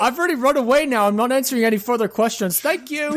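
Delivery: quick, high put-on Irish accent; Irish Lass. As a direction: rattle it off in a mock Irish voice. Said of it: "Irish Lass three four five six".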